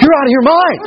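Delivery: high-pitched mock disbelief; scoffing disbelievers. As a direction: dismiss her news as crazy. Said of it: "they said to her".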